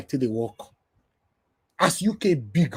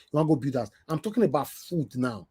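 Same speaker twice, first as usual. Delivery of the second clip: normal talking voice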